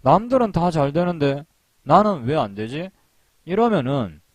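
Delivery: normal talking voice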